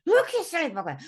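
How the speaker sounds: loud, high mock exasperation breaking into laughter; tactless exasperation. Telling the bloke where the robin is, tactlessly exasperated. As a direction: blurt it out loudly, half laughing. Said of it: "a bit more tactful than me".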